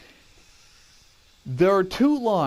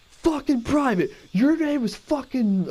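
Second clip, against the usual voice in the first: high, slow, in-your-face bark, imitated; drill sergeant; 10 semitones higher, slower than usual. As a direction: chew out a recruit in disbelief. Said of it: "He goes".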